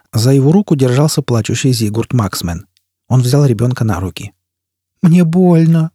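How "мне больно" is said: put-on high child's whine; small whining voice, pitched up; whimpering child. A narrator's reading. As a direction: whimper it like a hurt little child. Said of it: "скулил Зигурд".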